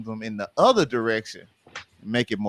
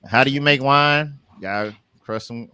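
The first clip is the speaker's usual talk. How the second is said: usual speaking voice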